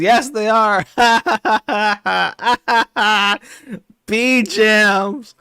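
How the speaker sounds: high, loud put-on yell breaking into laughter; laughing mock scream. Worski screaming while being stung by bees. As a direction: shriek it as if stung. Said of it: "screaming because he's still being stung".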